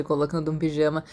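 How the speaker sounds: ordinary delivery, nothing marked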